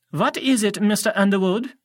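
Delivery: put-on high, stern French-accented teacher. A narrator's reading. Doctor Thorne challenging a student, French accent. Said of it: "He had an accent, uh, French maybe?".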